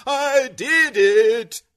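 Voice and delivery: loud, high, drawn-out cry of triumph; jubilant, almost crying. A narrator's reading. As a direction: wail it out in overjoyed disbelief. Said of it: "almost crying".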